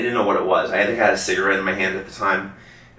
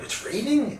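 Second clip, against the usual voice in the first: high-pitched mock bewilderment; bewildered question; slower than usual. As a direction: retell your puzzlement, pitched well up. Bewildered quote of own reaction.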